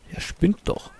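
whispered under the breath; a whispered grumble to himself; whispered aside. Real, not acted. Gamer whispering scorn about the greedy crewman.

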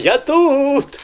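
high, loud drawn-out echo; hammed-up high holler after a laugh; mock answering call. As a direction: playfully imitate a child calling back. Echoes the child's answering call.

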